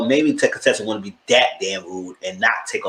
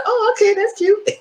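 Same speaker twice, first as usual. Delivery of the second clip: much higher, sweet falsetto; mock cutesy reply. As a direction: play it coy and teasing. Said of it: "So I said".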